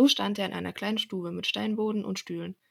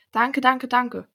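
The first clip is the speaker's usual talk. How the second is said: high, loud chorus of thanks; children calling out; 4 semitones higher, 9 dB louder, much slower than usual. A narrator's reading. Children thanking the carpenter for their gifts, calling out. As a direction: cry it out in a joyful rush. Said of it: "riefen die Kinder".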